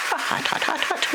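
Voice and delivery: high, panting hot hot; fanning a burning mouthful; mouth full of hot food. Real, not acted. Burned mouth tasting noodles.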